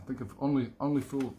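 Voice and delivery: ordinary talk, nothing marked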